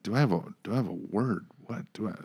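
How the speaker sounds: half-asleep confused voice, trailing very soft then rising; lightly acted groggy confusion; just woken up. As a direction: sound roused from sleep, lost and fumbling. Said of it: "coming out of a sleep".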